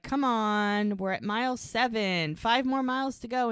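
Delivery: loud running-partner pep talk; urging on. Retold or imitated. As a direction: cheer a flagging runner on, acted out. Husband urging runner on, acted.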